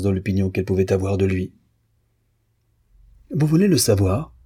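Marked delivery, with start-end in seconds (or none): 3.3-4.3 high, rising challenge; sudden challenge. A narrator's reading. Pianist turning suddenly to face him, challenging. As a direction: throw the question at him. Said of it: "fit tout à coup la petite pianiste".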